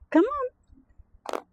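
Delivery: very high come on; high-pitched coaxing. Real, not acted. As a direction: coax them up high. Calling puppies inside.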